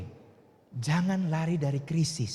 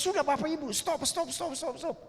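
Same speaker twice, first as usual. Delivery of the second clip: high, pleading cries of stop; 10 semitones higher than usual. Retold or imitated. Pastor hugging the attackers, begging them to stop, high pleading. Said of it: "saya bilang".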